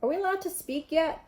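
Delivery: sulky, annoyed question; grumpy. A narrator's reading. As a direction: grumble it like a bored boy. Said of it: "said Ron grumpily".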